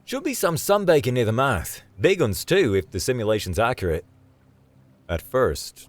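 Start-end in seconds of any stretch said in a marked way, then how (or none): 0.0-4.0 higher, louder, chirpy Australian guide; excited Aussie lad; eager Aussie David. Acted. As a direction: enthusiastic outdoorsman showing the group around.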